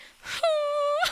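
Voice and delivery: high, loud hooting mock wail; imitated weird crying. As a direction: cry in long puppy-like howls. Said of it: "just listening to it, she's like".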